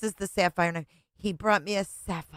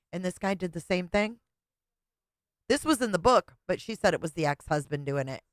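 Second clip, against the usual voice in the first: ordinary talk, nothing marked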